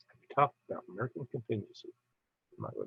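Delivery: quick puzzled mutter rereading a viewer question; muttered to self. Real, not acted. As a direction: repeat it quickly to yourself, puzzled. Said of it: "repeated to himself".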